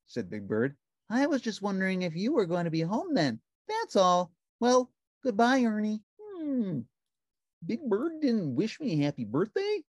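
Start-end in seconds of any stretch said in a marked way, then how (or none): 1.1-6.0 gentle, innocent giant bird; Big Bird voice. A narrator's reading. Narrator voicing Big Bird calling Ernie on the phone, high and sweet. 6.2-9.8 high, bright puppet voice; cheerful little puppet; Ernie voice. A narrator's reading. Narrator voicing Ernie answering the phone, high puppet voice.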